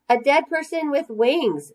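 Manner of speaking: put-on high, eager child's answer; kid's answer. A narrator's reading. Girl answering the teacher, high and eager. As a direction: say it like a keen third grader.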